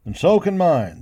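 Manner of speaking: ordinary delivery, nothing marked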